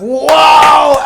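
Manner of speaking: huge, high, drawn-out "wow"; hammed-up roar of amazement; amazed crowd shout. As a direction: yell it like a whole astonished room. Everybody reacts amazed to the feat.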